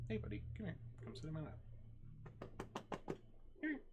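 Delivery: high, coaxing call to a pet. Real, not acted. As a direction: sweet-talk the little dog over. GM coaxes pet onto lap.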